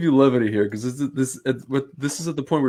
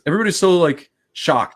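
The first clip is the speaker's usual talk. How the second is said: exasperated; 5 semitones higher, 7 dB louder than usual. Real, not acted. Venting about shocked friends.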